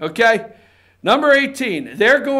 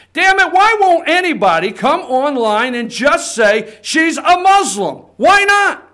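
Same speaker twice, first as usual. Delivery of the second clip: heated, rising higher and louder at the end; exasperated outburst; 3 semitones higher, 3 dB louder than usual. Real, not acted. Host demanding that somebody online speak bluntly, exasperated.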